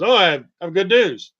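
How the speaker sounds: mock cheerful doctor voice; quoting the doctor. Surgeon leaning over patient before anesthesia, mock-cheerful announcement. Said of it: "he leans over and he says".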